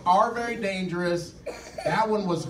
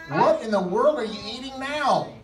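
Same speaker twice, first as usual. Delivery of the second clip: surprised; 2 semitones higher, 6 dB louder than usual. Acted. Friend startled by mushroom snack.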